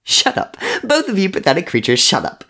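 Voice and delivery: loud, high, fast outburst; sharp, shouted scolding; barked order. A narrator's reading. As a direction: bark at them to silence them. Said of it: "Henry barked".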